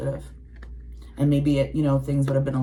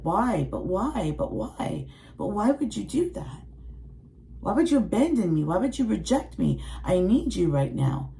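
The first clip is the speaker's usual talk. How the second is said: put-on inner child pleading; 6 semitones higher than usual. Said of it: "And she's like".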